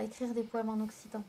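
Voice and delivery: ordinary delivery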